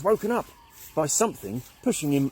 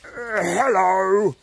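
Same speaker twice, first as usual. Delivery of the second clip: slow, louder, friendly call; friendly half shout; 6 semitones higher, 6 dB louder, much slower than usual. Acted. Caveman greeting the squirrels in a friendly half shout. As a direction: call out kindly, not angrily. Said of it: "in a more friendly half shout".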